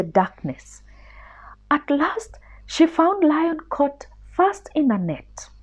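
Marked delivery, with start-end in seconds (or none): none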